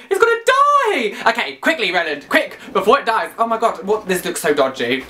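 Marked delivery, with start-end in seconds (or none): 0.1-1.3 high, drawn-out cry of panic; panicked wail. Real, not acted. Panicking over dying battery.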